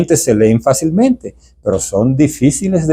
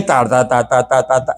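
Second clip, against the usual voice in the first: rattling on breathless; 2 semitones lower than usual. Retold or imitated. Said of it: "no respiran".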